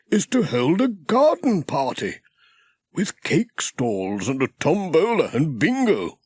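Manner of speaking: a kindly country vicar intoning a proverb; Reverend Timms. Acted. Vicar character voice.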